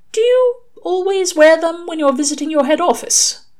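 very high, careful questioning; Aziraphale, curious. A narrator's reading. Aziraphale asks Crowley about his glasses, curious. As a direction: ask it high-pitched and careful.

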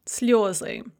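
"sliozy" with stressed yo in the first syllable. Shown as a demonstration. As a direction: say "sliozy", landing on the yo. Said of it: "really a yo".

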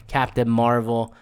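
usual speaking voice

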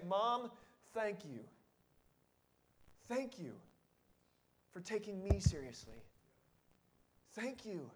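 put-on soft, hushed grateful child; a child softly thanking mom; tender thanks. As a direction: quietly thank her from the heart. Child thanking mother, tender imagined.

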